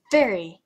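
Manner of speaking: very, hit loud and falling; stress on very. Real, not acted. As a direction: punch the first very. Stressing how interesting it is.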